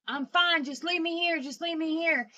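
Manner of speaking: exasperated reenacted plea; retold angry insistence. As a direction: insist loudly on being left alone. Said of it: "he screams, screams, and he cusses".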